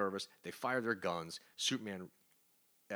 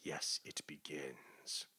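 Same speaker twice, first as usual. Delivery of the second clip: sinister gloat; 5 semitones lower, 7 dB quieter, much slower than usual. Retold or imitated. Ozymandias gloats quietly.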